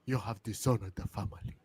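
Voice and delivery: low, hushed put-on accent of a grave mother; soft, deep mock-solemn accent; Kofi's mom. Mother shames her son, low solemn mock accent. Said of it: "his mom was like".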